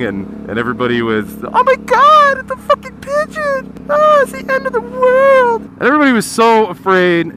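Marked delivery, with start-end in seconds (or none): 1.4-5.6 shrill falsetto panic, way up high; mock panic. Mimicking coworkers panicking over a pigeon, high mock shriek. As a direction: squeal like a terrified office crowd.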